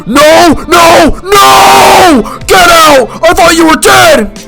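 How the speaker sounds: high, loud cries of disbelief; horrified shouting. Acted. Teacher to man thought dead, horrified shouting. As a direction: shout as if facing a ghost.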